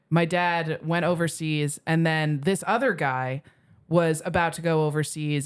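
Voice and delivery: ordinary delivery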